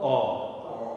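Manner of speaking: pure open "o", no glide; rounded ah. Shown as a demonstration. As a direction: open "o" made by rounding the lips on ah. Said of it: "just round the lips a little bit".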